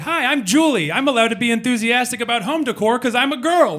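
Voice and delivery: high, bubbly and bright-eyed; perky roommate. Retold or imitated. New roommate Julie introducing herself to the guys, high and perky. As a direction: chirp it like an eager new roommate. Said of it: "She's like".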